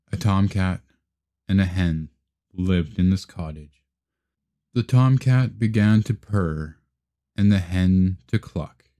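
plain reading voice

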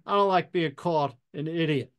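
usual speaking voice

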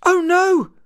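a little chick's high cry of panic; panicked chirp. A narrator's reading. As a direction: squeak it out in alarm. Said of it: "Chick chirped in panic".

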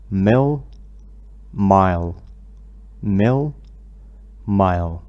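teacher contrasting word pairs; minimal pair drill. Shown as a demonstration. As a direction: set each pair apart for the class. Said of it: "رددوا من بعدي".

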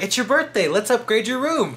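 sunny front-desk voice announcing a room upgrade; cheery hotel clerk. Retold or imitated. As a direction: play the upbeat desk clerk offering a treat. Hotel staff quoted offering upgrade.

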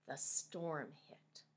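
slow suspenseful whisper. A narrator's reading. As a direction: hush it, slow and tense. Storyteller builds suspense.

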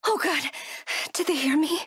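ordinary delivery

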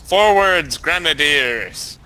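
loud, slow, drawn-out order; commander's call. Pretend. Player orders grenadiers forward, playful commander's call.

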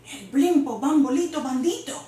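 ordinary delivery

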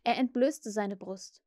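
usual speaking voice

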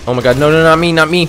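loud high panicked no; panicked pleading. Real, not acted. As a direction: beg in panic. Being attacked.

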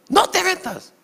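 high, loud warning yell; shouting. Retold or imitated. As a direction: yell at someone to stay out. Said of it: "Como así grito". (grito de regaño, muy agudo)

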